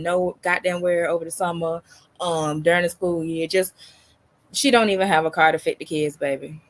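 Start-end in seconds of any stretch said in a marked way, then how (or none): none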